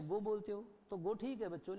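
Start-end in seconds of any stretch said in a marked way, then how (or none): 0.0-0.2 "गो" with one pure o; plain single-vowel "गो"; usual pronunciation GO. Shown as a demonstration. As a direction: say "गो" with no u-glide. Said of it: "आप इसको क्या बोलते हो".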